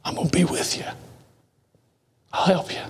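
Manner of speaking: hushed reassuring whisper. Acted. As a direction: whisper God's promise tenderly. Voicing God's promise.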